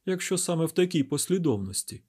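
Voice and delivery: high-pitched character reply; woman character's voice. A narrator's reading. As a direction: lift the pitch well up for her line. Narrator voices Stemfulher in dialogue.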